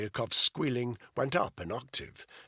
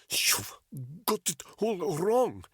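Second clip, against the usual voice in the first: put-on higher, pained Russian-accented English; thick Russian accent; 9 semitones higher, 3 dB louder, slower than usual. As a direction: push through pain in a thick Russian accent. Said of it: "with a thick Russian accent".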